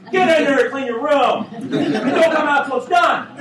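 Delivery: high, loud mock dad yell; dad's order. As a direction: yell at the kids to clean up. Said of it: "That's how we talk".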